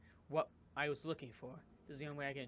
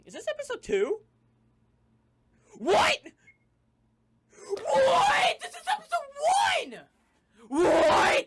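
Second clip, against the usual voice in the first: much higher and louder, disbelieving screech; shocked shriek; 19 dB louder, slower than usual. Real, not acted. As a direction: yell in total disbelief. Player shocked by episode.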